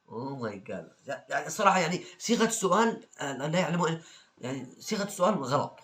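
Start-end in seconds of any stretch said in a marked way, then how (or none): 0.1-1.1 exasperated sigh. Real, not acted. Exasperated reaction.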